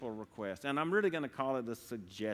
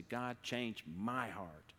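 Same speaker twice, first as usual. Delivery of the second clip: slow, softer prayer, "my" drawn out; stress on MY; 5 dB quieter, much slower than usual. Shown as a demonstration. Preacher showing tonight's prayer to God, stress on "my". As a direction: draw out "my" in a quiet plea. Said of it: "put the emphasis on the middle word".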